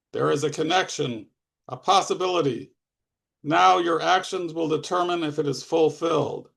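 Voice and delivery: usual speaking voice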